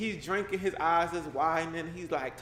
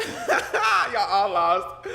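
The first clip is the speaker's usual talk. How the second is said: drawn out, louder and laughing; 4 semitones higher, 8 dB louder, much slower than usual. Real, not acted. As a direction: tease the chat through laughter. Laughing at confused chat.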